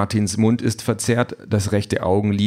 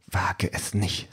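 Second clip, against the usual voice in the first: quiet threat; 7 dB quieter, much slower than usual. A narrator's reading. Wife warns husband blocking the door, quietly threatening. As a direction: warn quietly, spacing it out slowly.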